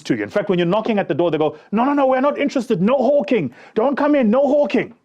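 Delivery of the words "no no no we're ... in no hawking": mock firm refusal. Offices refuse the promoter.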